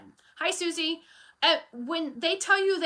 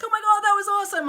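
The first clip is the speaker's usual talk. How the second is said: higher, louder, fast delighted gush; excited praise; 8 semitones higher, 7 dB louder, faster than usual. Retold or imitated. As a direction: gush like thrilled coworkers. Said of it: "they're like".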